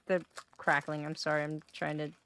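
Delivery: talking through a mouthful; chewing while talking. Real, not acted. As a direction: mouth full of crackers. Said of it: "eat peanut butter crackers".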